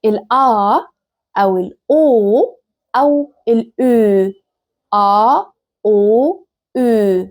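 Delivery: "آ", "أو", "أو" as long a, o, u, one by one; slow, sustained vowel demonstration of "آ" and "أو"; isolated German vowels. Shown as a demonstration. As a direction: draw out each vowel "آ", "أو", "أو" separately. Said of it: "واحد من الحروف التلاتة دول".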